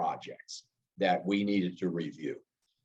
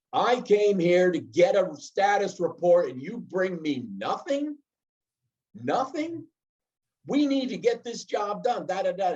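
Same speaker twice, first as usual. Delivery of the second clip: high mock yell at the employee; raised-pitch imitation of a boss chewing someone out; retold screaming boss; 7 semitones higher than usual. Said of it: "he's screaming at me".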